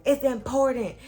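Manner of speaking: loud, high urgent insistence; urgent insisting. Retold or imitated. Cousin urging mom to come, loud and high. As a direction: press hard, it matters. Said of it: "my cousin was like".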